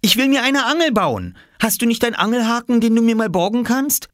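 put-on a small boy begging excitedly; eager little boy. Oskar asking the farmer for a fish hook, eager.

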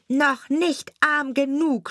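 high, slow, measured taunt; taunting sparrow. A narrator's reading. Sparrow to the lamenting carter, high deliberate taunt. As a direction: pitch it up and spell out each word at the carter.

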